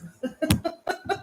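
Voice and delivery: a real burst of high laughter. Real, not acted. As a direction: laugh at your own joke. Laughs after ancestor quote.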